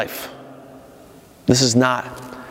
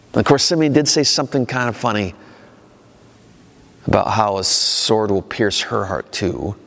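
ordinary talk

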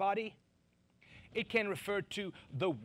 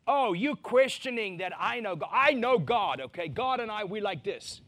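loud, higher, smug and huffy; mock someone insisting they know God; defensive boaster; 5 semitones higher, 8 dB louder than usual. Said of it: "it's like".

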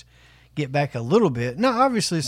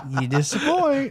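laughing mockery; 11 semitones higher, slower than usual. Mocking quote, laughing.

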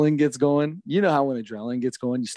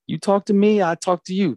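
higher, firm, steady words to settle him; firm calming; 7 semitones higher than usual. Retold or imitated. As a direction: talk a riled-up umpire down, firmly. Calming the angry umpire down.

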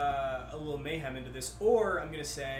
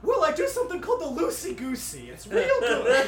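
excited; 10 semitones higher, 11 dB louder than usual. Acted. Bartender pitching his drink.

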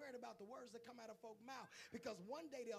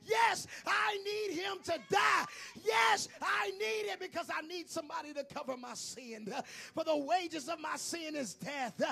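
slow, loud, higher shouting; desperate sinner; 7 semitones higher, 18 dB louder, slower than usual. Acted. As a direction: holler it like your soul depends on it. Voicing sinner who needs Jesus dead.

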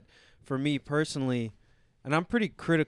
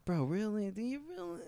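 inner conscience voice; 7 semitones higher, 6 dB quieter than usual. Retold or imitated. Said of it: "It's being like".

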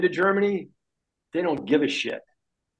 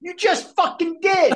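mock indignant; 13 semitones higher than usual. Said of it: "Like someone saying".

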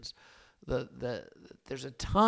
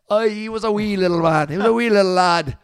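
loud, high hammed-up Scottish lilt; mock Scottish accent; 6 semitones higher, 16 dB louder than usual. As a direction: belt it out like a jolly Scotsman reading scripture. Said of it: "the Scottish translation".

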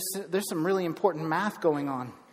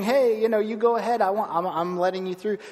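higher, louder, eager to let them pass; eager politeness; 4 semitones higher, 5 dB louder than usual. Retold or imitated. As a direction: insist warmly that they go first. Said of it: "You're saying".

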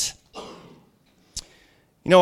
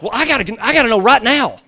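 louder, higher mock urgency; pressured demand; 5 semitones higher, 6 dB louder, faster than usual. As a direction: act out being pushed for an answer. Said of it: "if they pressure you".

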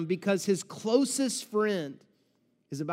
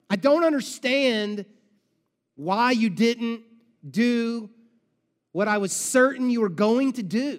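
louder, slowed, stammering confusion; upset stammering plea; 4 semitones higher, 5 dB louder, slower than usual. Acted. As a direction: demand answers while hurt and bewildered. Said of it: "She's upset".

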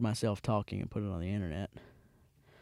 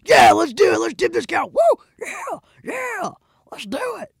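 high, loud hammed-up whoops; whooping cheer; 11 dB louder than usual. Passengers cheering the cow-tipping plan, loud mock whoops. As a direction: cheer like rowdy friends egging each other on. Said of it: "everyone in the car is like".

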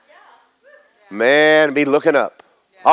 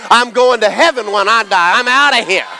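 higher, louder building sermon shout; preaching at full force; 9 semitones higher, 3 dB louder than usual. Real, not acted. Preacher proclaiming heaven to the congregation, full force. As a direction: preach it with rising fire.

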